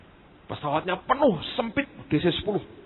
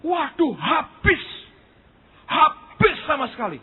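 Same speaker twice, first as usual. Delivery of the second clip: imitating enthusiastic storytelling; 11 semitones higher, 3 dB louder than usual. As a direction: stretch out the bad news with great relish. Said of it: "Om ini cerita dengan semangat".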